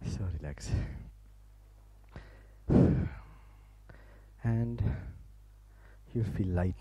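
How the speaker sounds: soft, low guided relaxation voice. Acted. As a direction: lull the listeners into stillness, a near whisper at the end.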